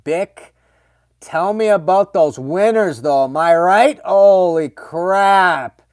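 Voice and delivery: loud, high excited call-out to chat; excited shout. Real, not acted. Streamer shouting to Bick about Saskatchewan winters, loud and excited. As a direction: hype up the viewer about brutal winters.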